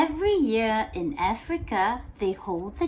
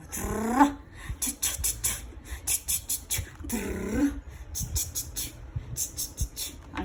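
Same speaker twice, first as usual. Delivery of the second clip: softer rolled drum and whispered cha-cha; playful rhythm imitation; cha-cha beat; 2 semitones lower, 7 dB quieter than usual. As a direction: mimic a Latin percussion fill.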